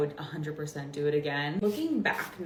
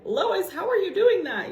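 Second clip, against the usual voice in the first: high, loud squeaky meme voice; meme voice quote; 13 semitones higher, 8 dB louder than usual. Retold or imitated. Quoting the viral clip to the viewers, high squeaky voice. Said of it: "that sound that's like".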